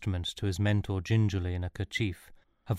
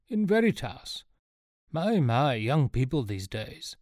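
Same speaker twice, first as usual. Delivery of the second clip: put-on old professor; 4 semitones higher, slower than usual. A narrator's reading. Professor marvelling at young Sean, in character voice. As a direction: voice a marvelling senior scholar.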